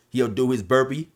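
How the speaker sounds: ordinary talk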